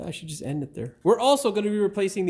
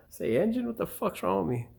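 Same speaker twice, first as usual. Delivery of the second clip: normal talking voice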